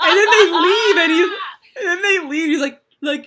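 high, loud, helpless laughter through the words; really laughing while trying to get the story out; cracking up. Real, not acted. Host can't finish the surf shop story, cracking up.

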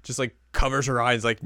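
ordinary delivery

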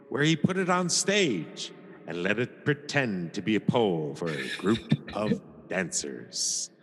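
ordinary talk, nothing marked

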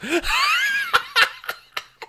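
high, loud burst of laughter; helpless cackle; cracking up. Real, not acted. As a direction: burst out laughing mid-performance. Cracks up at shrimp tails line.

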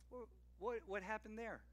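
soft, hushed wondering question; curious child. Retold or imitated. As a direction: ask like a curious kid peeking in. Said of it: "they're inquisitive".

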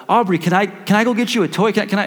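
put-on eager, high-pitched little boy asking; excited son. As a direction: imitate a kid bubbling over with an offer. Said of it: "excited about life".

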